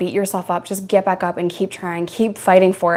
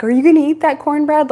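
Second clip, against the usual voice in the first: lightly mimicked question, pitched up; higher-voiced mock inmate asking; imitating other women; 7 semitones higher, 4 dB louder than usual. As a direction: put on another inmate's voice, higher. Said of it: "other women were like".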